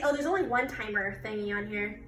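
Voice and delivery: ordinary delivery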